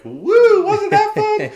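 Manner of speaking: very high, squealy sitcom voice; squeaky mock cheer. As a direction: squeal it like a cheesy TV lover. Mocking cheesy TV afterglow line.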